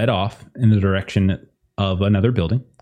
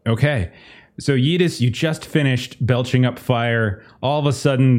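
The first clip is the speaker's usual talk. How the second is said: ordinary talk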